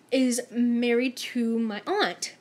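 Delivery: slow, weighty reveal of the news. Retold or imitated. Coworker reveals the family link, slow and weighty. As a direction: let each word land slowly.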